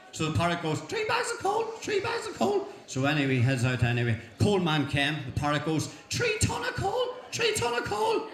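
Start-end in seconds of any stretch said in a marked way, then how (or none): 0.8-2.7 very high squawky parrot voice; squawking parrot. Acted. 6.1-8.3 very high squawky parrot voice; squawking parrot. Acted. Parrot squawking to coal man.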